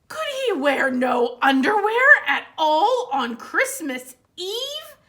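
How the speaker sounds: ordinary delivery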